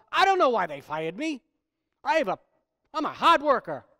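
loud, indignant mock complaint; angry protest. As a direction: act out a fired worker's angry grievance. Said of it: "as they say it in anger".

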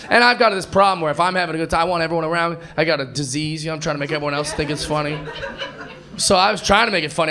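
normal talking voice

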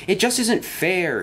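high, drawn-out complaint; slow, high-pitched grumble; complaining. A narrator's reading. Henry complaining to the others.

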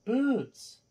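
sharp high call to the cat; loud sharp voice. Real, not acted. Calling the meowing cat again.

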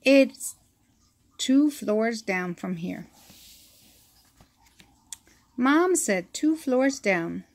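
ordinary delivery, nothing marked

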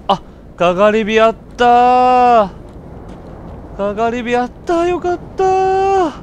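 high, slow drawn-out cry of relief; relieved joy. Real, not acted. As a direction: stretch it out with delight. Relief at finding bonfire. (安堵して嬉しそうに伸ばして)

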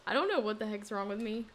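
ordinary delivery

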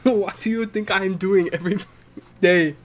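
put-on Jamaican accent, mock exasperation; imitated Jamaican accent. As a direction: repeat the punchline in a hammed-up Jamaican accent. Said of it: "clearly not an American accent".

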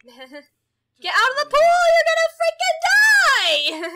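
high, loud slowed shriek; laughing scream. Real, not acted. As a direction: scream them out half laughing. Screaming at sims in pool.

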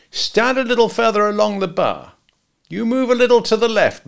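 lightly voiced hitman in charge; gangster giving orders. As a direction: place them like a photographer with a gun. Al arranges them from kitchen.

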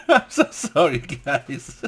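apologizing through real laughter; can't hold it together, laugh through it; giggling sorry between laughs; laughing apology. Real, not acted. Cracking up at own joke.

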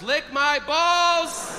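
high, very drawn-out outburst; tic outburst. Acted. Kid with Tourette's during Bible reading, drawn-out high outburst. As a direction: stretch it out high like an involuntary cry.

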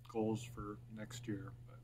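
ordinary talk, nothing marked

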